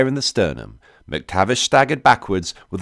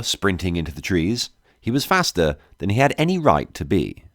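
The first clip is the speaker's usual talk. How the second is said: ordinary delivery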